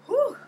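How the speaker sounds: high loud relief exhale; loud high whew of relief; relieved whew. Retold or imitated. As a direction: blow out in relief. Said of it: "I was like".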